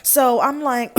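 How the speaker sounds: normal talking voice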